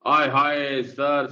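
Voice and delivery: hammed-up aaye haaye; mock swoon. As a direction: play an awed student swooning. Mock student swooning at point.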